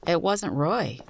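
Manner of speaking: ordinary delivery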